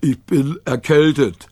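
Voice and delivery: put-on stuffed-up dog voice; feigned cold. A narrator's reading. Rover making excuses to the Gigglerin, feigned cold. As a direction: fake a head cold.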